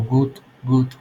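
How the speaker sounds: good with a long stretched u and no l. Shown as a demonstration. Teacher modelling good for the class, long u sound. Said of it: "es una U alargada".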